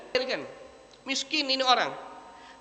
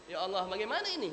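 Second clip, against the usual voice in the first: a touch of a tearful plea, soft and distant; lightly voiced despairing father, weeping; mild tearful cry to God; crying prayer; 5 dB quieter than usual. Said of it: "dia nangis".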